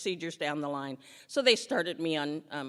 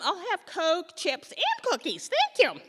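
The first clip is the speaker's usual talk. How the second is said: higher, louder chirpy order, slowing on the list; 11 semitones higher, 6 dB louder than usual. Retold or imitated. Ordering Coke and cookies at chemo, a high chirpy voice. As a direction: order snacks like a happy customer. Ordering treats at the next visit.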